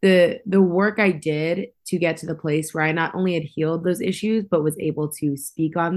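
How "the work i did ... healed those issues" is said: usual speaking voice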